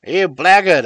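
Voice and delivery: loud, high furious shout; father shouts at his son in rage; raging shout. A narrator's reading. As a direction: hurl the insult in rage. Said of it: "I shouted, beside myself with rage".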